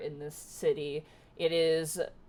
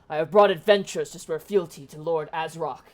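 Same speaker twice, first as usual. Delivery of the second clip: hobgoblin guard; 7 dB louder than usual. Acted. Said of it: "you hear the one hobgoblin go".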